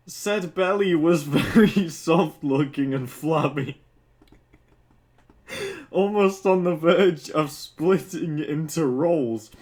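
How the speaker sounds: real laughter breaking the read; laughing while reading. Real, not acted. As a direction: struggle to read through laughter.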